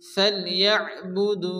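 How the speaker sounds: ফাল ইয়াবুদু slowly with দু held long; slow careful. Shown as a demonstration. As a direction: draw out the দু of ইয়াবুদু. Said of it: "এক আলিফ পরিমাণ টান দিয়ে পড়তে হবে".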